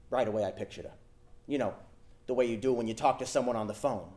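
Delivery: ordinary talk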